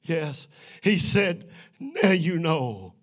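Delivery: loud, high, drawn-out revival preaching; fervent preacher's shout over a congregation; full-force preaching. Real, not acted. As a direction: preach it out, building to a sustained shout.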